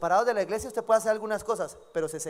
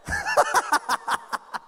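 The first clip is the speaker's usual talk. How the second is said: a burst of high laughter; 5 dB louder than usual. Real, not acted. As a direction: laugh out loud at the joke. Pastor laughs at engagement story. (risa aguda)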